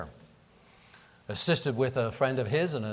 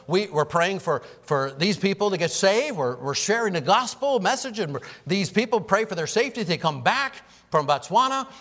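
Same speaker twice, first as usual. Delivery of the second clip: raised, louder preaching voice; 7 semitones higher, 6 dB louder than usual. Acted. Pastor urges the church on about missionaries, preaching fervently. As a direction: preach it out with rising fervour. Preaching builds to full force.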